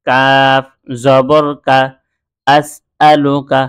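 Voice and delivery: each letter and vowel mark drawn out slowly; slow spelling drill. Shown as a demonstration. Teacher spelling Arabic letters for students, slow drill. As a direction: spell it out syllable by syllable like a Quran teacher. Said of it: "এখানে আলিফকে হামজা বলেছি".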